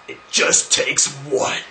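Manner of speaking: slow, voiceless stage whisper; mock-sinister whisper. Answers the muffin world-domination question, mock-sinister whisper. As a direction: draw out the punchline in a loud whisper.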